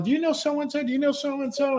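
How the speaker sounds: high-pitched imitation of an eager mom; mom quizzing. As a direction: voice Mom firing off questions, pitched well up. Mom quizzing stranger, retold.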